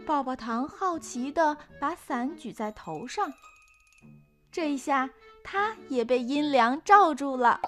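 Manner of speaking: usual speaking voice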